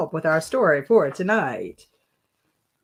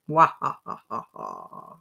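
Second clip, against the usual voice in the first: vampire laugh; 4 dB quieter than usual. Acted. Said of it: "vampire voice".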